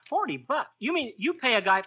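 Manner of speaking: normal talking voice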